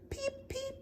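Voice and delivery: tiny, very high, very soft peeps; chick's peep. Acted. Reader voices the hen's little chick, tiny high peeps. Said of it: "her little chick".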